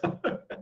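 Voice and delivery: soft put-on chuckle in a rhythmic run; mock laughter, quiet and repetitive; imitated laughing fit. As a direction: act out someone starting to laugh alone. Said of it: "assim, ele começa".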